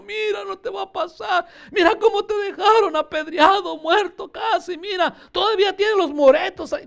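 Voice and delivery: high-pitched wailing plea; hammed-up sniveling entreaty; tearful whining. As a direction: whimper and beg as if crying. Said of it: "estaban lloriqueando ahí".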